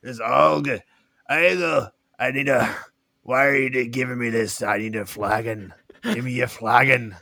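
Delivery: a hint of a cranky dwarf demanding his drink; grumpy dwarf.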